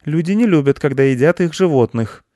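put-on earnest little boy objecting; lecturing boy. A narrator's reading. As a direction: tell him off in a high boy voice.

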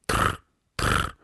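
two short rolled trills; beetle trill. Acted. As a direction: buzz twice like an insect. Beetle answers no.

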